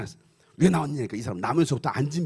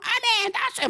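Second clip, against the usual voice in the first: very high mock cry of healing; high-pitched amen outburst; excited testimony. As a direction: imitate an overexcited believer. Congregant claiming to be healed.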